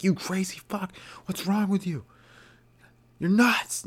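high-pitched, strained yell at a cat; retold yelling. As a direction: scold the cat in a squeaky outburst. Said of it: "I was yelling at him".

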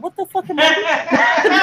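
high, slow, laughing disbelief; laughing at herself. Retold or imitated. Catching herself faking a voice, laughing in disbelief. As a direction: crack up at your own act.

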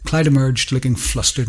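usual speaking voice

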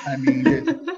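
high laughing voice while recalling chaos. Real, not acted. Guest recalling a wild family home, laughing. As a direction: laugh through the words.

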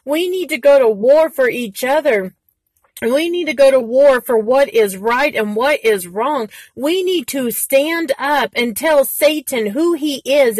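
fiery preacher exhortation; rally the congregation to battle; loud preaching push. Real, not acted. Preaching to viewers, fired up.

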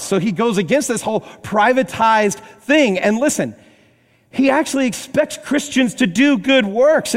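rising preacher's fervor; fiery preaching. Acted. Preaching at full force.

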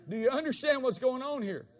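fiery pulpit preaching; full-force preaching. Acted. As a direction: preach it out to the crowd.

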